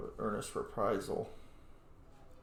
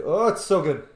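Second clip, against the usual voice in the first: excited delight; 5 semitones higher, 11 dB louder than usual. Real, not acted. Spotting a great weapon.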